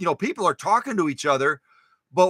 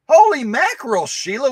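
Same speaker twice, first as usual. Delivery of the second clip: much higher and louder, amazed; amazed exclamation; 7 semitones higher, 8 dB louder than usual. Real, not acted. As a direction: burst out in amazement. Amazed at Sheila's animals.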